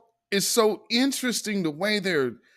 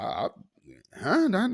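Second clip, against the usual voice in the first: ordinary talk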